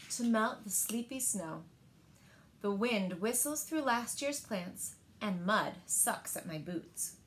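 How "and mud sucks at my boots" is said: usual speaking voice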